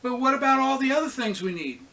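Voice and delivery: high, loud and fast tumbling question; breathless rush of worry and joy; excited disbelief. A narrator's reading. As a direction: rush it out, overwhelmed. Disbelieving girl rushes on.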